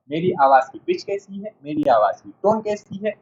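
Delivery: ordinary delivery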